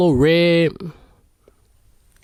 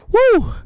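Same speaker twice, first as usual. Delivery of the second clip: a loud high woo; high excited whoop; 4 dB louder than usual. Real, not acted. Storyteller cutting off his own swear, high whoop. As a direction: whoop to cover the curse. Exclaims after cut-off curse.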